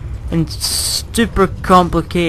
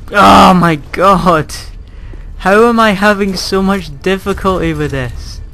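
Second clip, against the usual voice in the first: frustrated; 4 semitones higher, 5 dB louder than usual. Real, not acted. Gamer failing the same jump, loud frustration. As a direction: let the frustration boil over.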